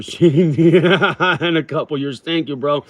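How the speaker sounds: amused praise breaking into a laugh. Real, not acted. Streamer laughing while complimenting a viewer.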